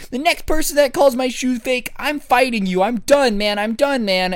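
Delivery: put-on wound-up kid threatening a fight; angry kid. Kid threatening the next classmate, high angry voice. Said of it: "he goes".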